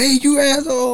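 high-pitched retold insult; mock angry yell. Some guy insulting Leo from alongside, irate. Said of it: "some guy's like".

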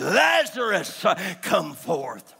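loud, high call falling away; preacher's cry of command; commanding divine call. Acted. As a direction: summon him with a booming shout. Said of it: "he spoke as God and said".

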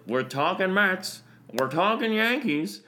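high, slow, put-on hype voice. Host announcing topics in a put-on hype voice. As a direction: sell it like a hype man.

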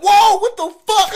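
high, loud startled yelp with a laugh. Retold or imitated. Shocked yelp at the resident behind the door. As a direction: jump back in fright. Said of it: "and I'm like".

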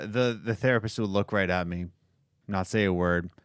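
usual speaking voice

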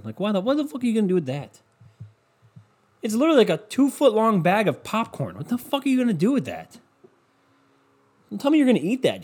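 high-pitched, exasperated disbelief; incredulous rant. Real, not acted. Ranting at people buying huge kettle corn bags, incredulous. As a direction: send the pitch way up in comic outrage.

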